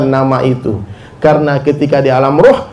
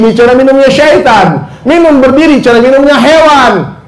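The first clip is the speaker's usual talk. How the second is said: higher, louder warning cry; emphatic warning; 8 semitones higher, 4 dB louder than usual. Acted. Preacher warning the crowd against drinking left-handed, loud and emphatic. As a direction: call it out as a stern warning.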